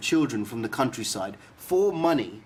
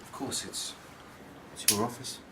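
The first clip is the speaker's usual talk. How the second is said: uneasy murmur; 10 dB quieter than usual. Acted. Uneasy reply to smoking.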